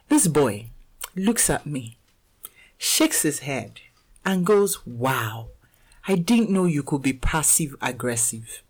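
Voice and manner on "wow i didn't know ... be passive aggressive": quiet, dry, unimpressed; deadpan remark. Retold or imitated. A son dryly judging his mom, quiet and unimpressed. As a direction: say it softly and flatly, like a disappointed son.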